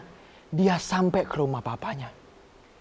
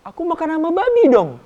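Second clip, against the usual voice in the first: youngest son; 10 semitones higher, 5 dB louder than usual. Acted. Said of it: "Terus anak bungsu bilang".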